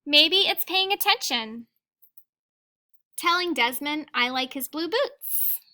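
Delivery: put-on reading a picture book aloud to children; gentle read-aloud storytelling; teacher reading to the class; story reading. A narrator's reading.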